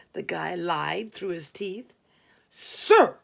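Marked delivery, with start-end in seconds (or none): 2.5-3.2 much higher and louder triumphant "Sir"; gotcha, announced in triumph; victorious. Retold or imitated. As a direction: call it out like you just caught him lying. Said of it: "I announced victoriously".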